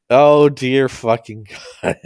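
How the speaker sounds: loud, slow exclamation breaking into laughter; appalled and cracking up; real amused disbelief. Real, not acted. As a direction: groan it out loudly between laughing fits. Exclaims between fits of laughter.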